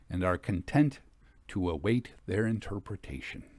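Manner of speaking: put-on measured, stately reading of an old essay; formal reading aloud. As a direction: read it like a grave Victorian author. Reads a Victorian text aloud.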